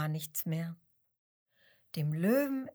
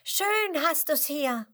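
high chirpy call from a small bird; bright calling voice; 8 semitones higher, 5 dB louder than usual. A narrator's reading. Penguin calling out to the bored lion, high and bright. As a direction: call out brightly, higher than the narrator. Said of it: "eine helle Stimme, die ruft".

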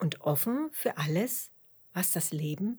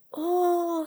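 high, louder drawn-out oh; mitfühlendes Oh; 12 semitones higher, 5 dB louder than usual. A narrator's reading. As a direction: react with concern, pitched up. Dotti pities the lost worm. (hohes, betroffenes Oh)

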